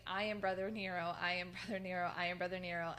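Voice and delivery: ordinary talk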